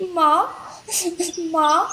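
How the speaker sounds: put-on high, small child's wail; crying lion cub. Lost lion cub crying for his mother, high wail. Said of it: "बच्चा फिर से रोते हुए कहता है".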